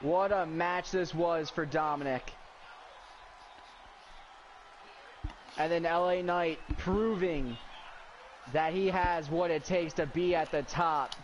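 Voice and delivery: energetic wrestling commentator read; play-by-play commentary. Acted. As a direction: call the match live.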